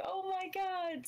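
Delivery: high-pitched, mildly excited "oh my God"; excited gasp retold. Fan retelling the moment they showed her, excited. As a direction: relive a thrilled reaction.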